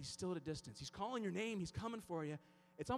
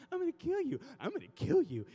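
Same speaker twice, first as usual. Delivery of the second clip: louder, swooping pet-talk threat; loud coaxing threat with leaping pitch; sing-song mock threat; 14 semitones higher, 7 dB louder than usual. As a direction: swoop up high on "kill" as if cooing to a dog.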